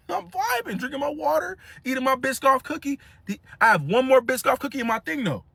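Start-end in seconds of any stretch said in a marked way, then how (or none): 0.1-3.0 high, carefree vibing; happy vibing. Real, not acted. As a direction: savor the moment, high and light. Happy on flight.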